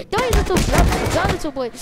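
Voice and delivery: high, loud pretend villain shout; villain yell. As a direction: scream it at the enemy toy. Toy villain attacks.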